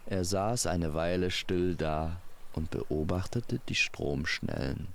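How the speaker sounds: plain reading voice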